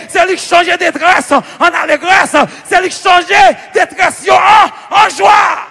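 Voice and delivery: high, shouted proclamation; full-force preaching. Real, not acted. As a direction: build a ringing, triumphant refrain.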